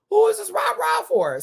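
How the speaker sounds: high, loud mocking squeal; squealing repeat. Repeating her retold question in a high mocking squeal. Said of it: "I was like".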